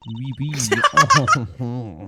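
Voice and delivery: pursed-lip French accent. Shown as a demonstration. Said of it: "like I'm trying to do French".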